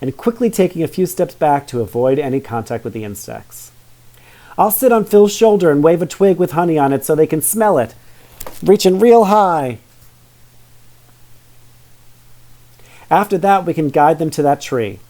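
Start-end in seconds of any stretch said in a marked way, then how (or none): 4.6-15.0 proud assertion. Acted. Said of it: "Vivian asserted".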